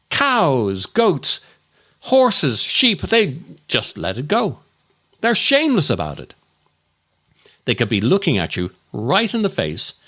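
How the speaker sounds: normal talking voice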